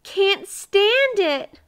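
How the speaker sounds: high, loud, drawn-out wail; weeping. A narrator's reading. Miser can't bear hearing about pies, weeping. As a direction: wail it out, slow and overwrought. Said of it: "wept Mister Miser".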